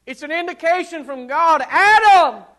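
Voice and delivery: loud, high, impassioned pulpit voice; full-force preaching. Acted. Preacher thundering to the congregation, full-force preaching. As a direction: preach it out to the back pews.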